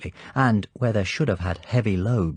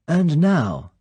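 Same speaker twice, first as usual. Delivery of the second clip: high, louder, animated villain's welcome; the Count's voice; 9 semitones higher, 6 dB louder than usual. Acted. Count welcomes Sir Hilary, light animated high voice. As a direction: greet the guest lightly, full of animation.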